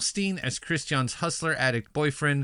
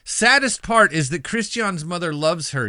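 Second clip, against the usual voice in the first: normal talking voice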